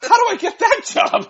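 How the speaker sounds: laughing envy. Retold or imitated. Retold eager envy, laughing.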